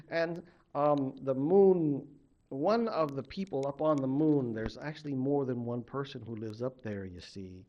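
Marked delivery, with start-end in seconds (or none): none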